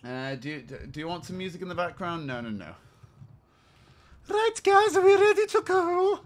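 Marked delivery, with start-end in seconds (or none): 4.3-6.2 very high put-on female voice; hammed-up high-pitched lady. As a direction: play a woman in a high, loud falsetto. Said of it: "let's put on some female voice".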